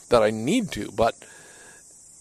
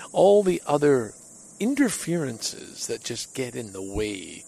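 ordinary delivery

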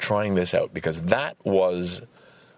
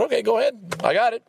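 eager self quote; 14 semitones higher, faster than usual. Retold or imitated. Quoting his own brain.

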